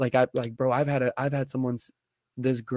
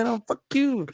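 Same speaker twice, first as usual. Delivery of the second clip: much higher squeaky put-on voice; mock comeback; 11 semitones higher than usual. Friend joins the bit, a hammed-up higher comeback. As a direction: ham up a petty retort.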